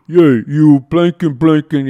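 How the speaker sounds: loud, slow, cursing boss yelling; angry boss. Retold or imitated. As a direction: bark at the kid like a foul-mouthed boss. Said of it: "And he goes".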